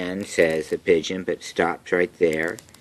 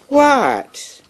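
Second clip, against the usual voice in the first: duck voice; 7 dB louder than usual. Acted. Duck's innocent question.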